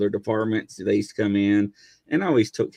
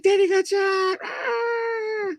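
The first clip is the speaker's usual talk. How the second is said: put-on high wailing little girl; child crying; much slower than usual. Little daughter sees daddy tased, high crying wail. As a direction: wail like a small child seeing daddy hurt.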